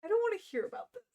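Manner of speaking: flustered, quick ban on teasing; embarrassed protest. Real, not acted. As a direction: shut the topic down in a flustered rush. Embarrassed, forbids further teasing.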